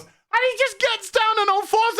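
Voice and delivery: high, squealing disbelief; incredulous. Real, not acted. Reviewer retelling sergeant on all fours, high-pitched and incredulous. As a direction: tell it as if you can't believe it.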